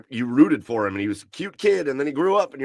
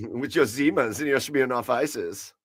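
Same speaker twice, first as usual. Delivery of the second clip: put-on German accent. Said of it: "But in a German accent".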